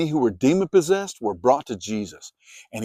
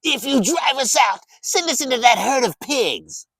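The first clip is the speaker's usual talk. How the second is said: much higher, eerie pleading demon voice; begging demons; 12 semitones higher than usual. A narrator's reading. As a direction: plead like cornered spirits. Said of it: "the demons begged Jesus".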